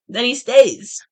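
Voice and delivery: half-successful attempt at a voice crack. A narrator's reading. Reader voicing a girl trying to sound different, mock voice crack. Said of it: "but my voice cracks".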